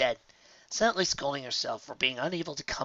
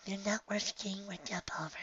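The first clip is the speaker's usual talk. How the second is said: soft high whisper; calm a friend, hushed; 8 semitones higher, 11 dB quieter than usual. Acted. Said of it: "she said softly".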